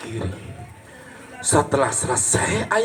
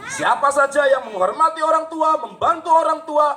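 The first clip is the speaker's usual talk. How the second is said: emphatic preaching; 10 semitones higher than usual. Real, not acted. Preaching moral to children.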